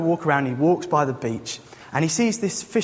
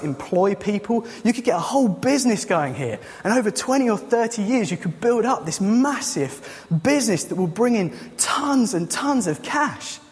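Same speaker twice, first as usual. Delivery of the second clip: higher-pitched, mildly excited salesman; eager business pitch; 6 semitones higher than usual. Acted. Businessman urges the fisherman to build an empire, mildly excited. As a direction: sell the big dream, voice lifted.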